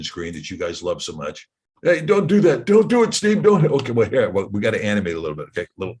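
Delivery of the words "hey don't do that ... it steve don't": higher-pitched pleading objection; comic protest, pitched up; mock protest. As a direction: play an alarmed heckler begging him to stop. Mimics audience objecting to annotation screen.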